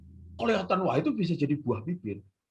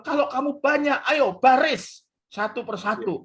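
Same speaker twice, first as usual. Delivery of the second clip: high, raised exorcist's order; mock command; 11 semitones higher, 5 dB louder than usual. Friend orders many demons about, high mock command. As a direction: marshal the demons like unruly troops.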